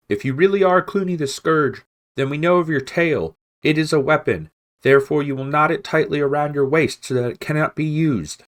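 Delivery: high, loud call from the parapet; crying down. Acted. Matthias demanding Clooney tie his tail, high loud call. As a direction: call the terms down hard. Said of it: "Matthias cried down".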